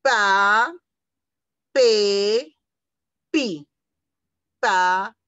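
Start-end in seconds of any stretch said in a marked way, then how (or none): 0.0-3.7 Pa, pe, pi each called out loud with a pause between; syllable reading. Shown as a demonstration. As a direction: chant the syllables one by one for little kids.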